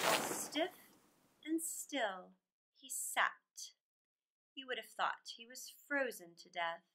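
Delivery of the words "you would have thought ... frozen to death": ordinary delivery, nothing marked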